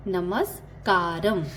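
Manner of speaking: नमस्कारम spelled out slowly, syllable by syllable; slow, drawn-out teaching repeat. Shown as a demonstration. Teacher slowly repeats Telugu greeting for students, drawn out. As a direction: stretch नमस्कारम out so learners can copy it. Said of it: "hello को तेलुगु में कैसे कहते हैं".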